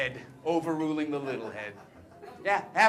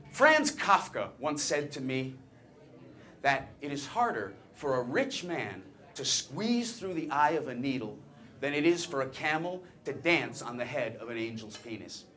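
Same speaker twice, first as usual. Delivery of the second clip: mock-solemn quotation; 4 semitones lower, 4 dB quieter than usual. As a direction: recite it as weighty literary wisdom.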